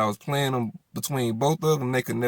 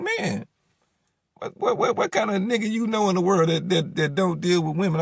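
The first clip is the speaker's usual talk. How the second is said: stammering, higher-pitched protest; indignant comeback; 8 semitones higher than usual. Retold or imitated. Man defending himself to baby mama, indignant. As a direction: argue back in disbelief, pitch jumping up.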